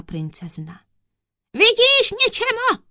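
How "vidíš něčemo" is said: very high, loud triumphant shout; judge's explosive outburst; thundering. A narrator's reading. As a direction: thunder it at the accused. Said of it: "zahřímal soudce na kouzelníka".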